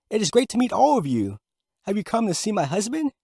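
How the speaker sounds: a wife greeting visitors; lightly high wife; Manette's voice. Acted.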